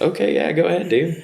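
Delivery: high, laughing put-on voice, humouring him; mock cheery okay. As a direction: play along sweetly with a sick buddy. Retells humouring sick friend Dan.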